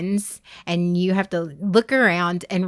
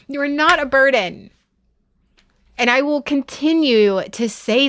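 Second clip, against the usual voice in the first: firm, raised mama voice; 6 semitones higher, 4 dB louder than usual. Real, not acted. Scolding a chat friend who doubts their worth, mama voice. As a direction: insist like a mother, high and emphatic. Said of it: "don't make me use my mama voice".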